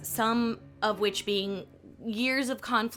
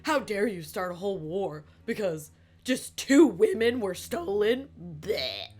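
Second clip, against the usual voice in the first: a hint of a whiny scold; mock indignant critic; 3 dB louder than usual. Aristophanes mocking Pericles, lightly voiced indignant sneer. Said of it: "Aristophanes was like".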